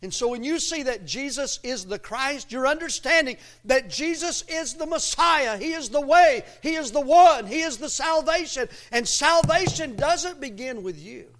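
high, loud pulpit proclamation; impassioned preaching. Real, not acted. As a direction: declare it fervently to the congregation. Preaches at full force.

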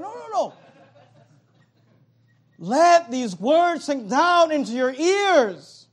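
slow, loud, deliberate words; emphatic teacher, drawn out; Jesus insisting. Retold or imitated. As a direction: press every word home slowly. Jesus voiced, slow and pointed.

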